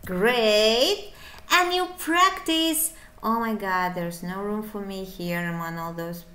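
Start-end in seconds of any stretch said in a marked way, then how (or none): none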